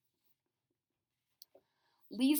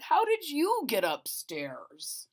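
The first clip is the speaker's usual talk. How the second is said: watchman voice; 2 semitones higher, 4 dB louder, slower than usual. A narrator's reading.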